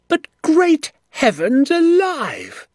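high, loud, stretched-out outrage; furious shout. A narrator's reading. Doctor appalled by the pit trap, shouting furiously. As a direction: explode with indignant fury. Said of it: "the little doctor shouted, jumping up and down with fury".

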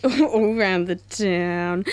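slow hammed-up DJ voice; drawn-out radio speak. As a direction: stretch it out like a cheesy radio host. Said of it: "Oh, radio speak".

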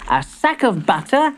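put-on high little-boy voice; high, innocent storybook child; young grandson ordering. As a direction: list the shopping as a small boy. Timbertwig ordering.

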